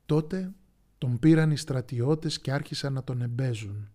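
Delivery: plain reading voice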